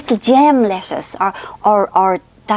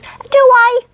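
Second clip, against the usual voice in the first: much higher, louder coy mammy voice; imitating mother; 5 dB louder than usual. Mother pretending not to know she must say thanks, high coy imitation. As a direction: play the innocent old mother, all high surprise. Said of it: "'Cause that's how she talks".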